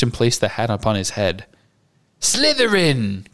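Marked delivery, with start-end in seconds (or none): 2.2-3.3 drawn-out, high proclamation; cried out. A narrator's reading. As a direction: announce it slowly, high and ringing. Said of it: "cried the Sorting Hat".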